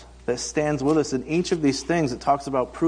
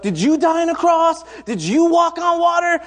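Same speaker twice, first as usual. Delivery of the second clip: put-on high, loud indignant child; imitating a child yelling; 13 semitones higher, 5 dB louder than usual. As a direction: yell it up high like a furious little girl. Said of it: "she yells out".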